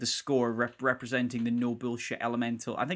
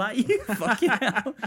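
loud, high, laughing disbelief; 9 semitones higher, 7 dB louder than usual. Real, not acted. Podcaster cracking up at film's absurdity, laughing. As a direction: crack up mid-sentence. Cracking up at his own joke.

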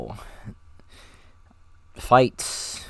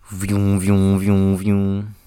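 mouth-made revving engine, vroom vroom; playful vocal engine sound effect; engine vroom noise; 4 dB louder, much slower than usual. Shown as a demonstration. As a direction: rev it like a toy car engine. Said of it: "figure out if I got any noises".

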